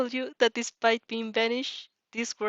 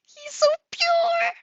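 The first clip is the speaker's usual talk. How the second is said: breathless whispered squeal, pitched way up; gushing squeal; 6 dB louder, slower than usual. Real, not acted. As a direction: squeal it under your breath, overwhelmed by cuteness. Reader gushing over the character.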